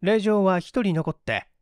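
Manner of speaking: ordinary delivery, nothing marked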